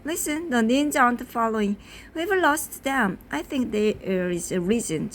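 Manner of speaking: plain reading voice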